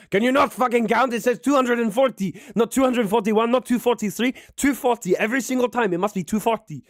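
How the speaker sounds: high, loud ranting at a server; furious chef rant. Acted. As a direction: tear into her over every millilitre. Chef berating server over measures.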